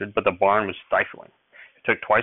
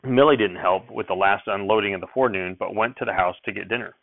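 ordinary delivery

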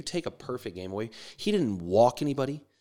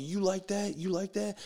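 high, held-back pitcher's taunt; mock taunting scream; 8 semitones higher, 3 dB quieter than usual. Pitcher to the batter he struck out, mock taunting. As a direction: taunt him in a high, restrained mock yell. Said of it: "fucking screaming his ass".

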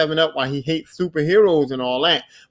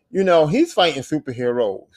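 ordinary talk, nothing marked

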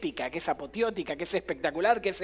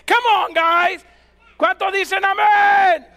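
high, loud rallying holler; crazy preacher shout; 10 semitones higher, 11 dB louder, much slower than usual. Acted. Paul as wild preacher rouses Corinthian church, crazed shout. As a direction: rouse the crowd, drawing out the last word. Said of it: "es tan loco como yo".

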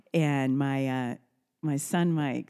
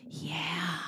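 tiny whispered yes; whispered child yes; 9 dB quieter than usual. Retold or imitated. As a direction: answer softly like a sleepy toddler. Grandson whispering his answer.

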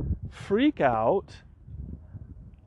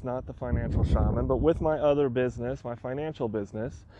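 ordinary talk

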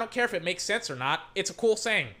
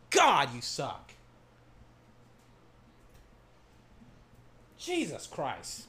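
slow, much lower and softer disgust; disgusted mutter; 8 semitones lower, 6 dB quieter, slower than usual. Real, not acted. Fan giving up on the referees, low disgusted mutter. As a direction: give up on the refs under your breath. Deflated after ranting.

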